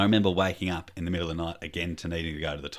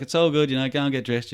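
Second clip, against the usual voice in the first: higher, louder acted cheer-along; overeager reassurance; 8 semitones higher, 6 dB louder than usual. Dad sends son to dress, acted high reassurance. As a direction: send a child off, pitched up and insistent.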